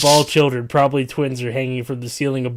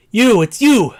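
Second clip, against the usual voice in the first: high, loud shriek of recognition; 9 semitones higher, 11 dB louder, slower than usual. Acted. Narrator shrieking at the girl in the cage. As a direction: shriek it out, much higher and louder. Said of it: "I shriek at one of the cages".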